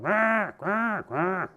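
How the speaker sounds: high, loud cawing crow noise; mock crow caw. Telling a story, the teller imitates a crow with a loud high caw. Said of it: "started going".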